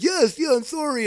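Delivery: high, loud mock pleading; imitating crying surrender. Crying kid against the car begging off, mock pleading. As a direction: imitate a beaten guy frantically giving in. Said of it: "I see that he's crying".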